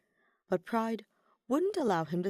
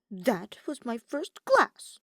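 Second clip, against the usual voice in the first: higher, louder and slow, affronted; offended protest; 5 semitones higher, 5 dB louder, slower than usual. A narrator's reading. Ferdinand offended that Hubert thinks he is drunk. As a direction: draw yourself up in offense. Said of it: "more than a little offended".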